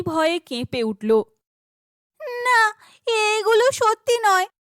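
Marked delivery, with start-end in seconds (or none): 2.2-4.5 much higher, louder panicked cry; scared girl crying out; frightened denial. Acted. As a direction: refuse the nightmare in fear. Terrified at visions in lake.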